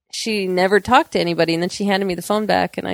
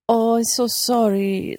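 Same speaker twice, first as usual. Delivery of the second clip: neighbor lady apologizing; 4 semitones higher, slower than usual. Retold or imitated. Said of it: "she comes over and goes".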